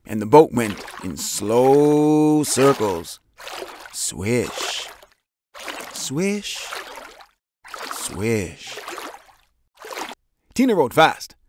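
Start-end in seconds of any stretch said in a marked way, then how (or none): 3.9-8.8 soft swishes. A narrator's reading. Boat circling sound effect.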